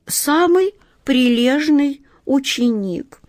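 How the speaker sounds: high, slow, demurely virtuous cat; modest and virtuous. A narrator's reading. Cat Васька finishing modestly, in a high, demure, virtuous voice. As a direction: draw it out high, all innocence. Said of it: "скромно и добродетельно опустив глаза".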